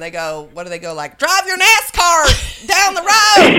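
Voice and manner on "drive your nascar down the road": mock American drawl. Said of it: "what do they say when people are like, "American".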